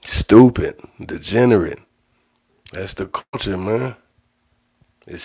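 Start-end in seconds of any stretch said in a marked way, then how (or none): 0.1-1.8 loud, higher, emphatic condemnation; punchy, raised-voice disgust; disgusted outburst. Real, not acted. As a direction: spit out each word in loud disgust. Condemning violent hazing in disgust.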